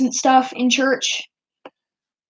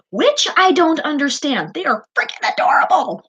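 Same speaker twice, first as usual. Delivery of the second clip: gushing; 9 semitones higher than usual. Real, not acted. Gushing about Teletubbies.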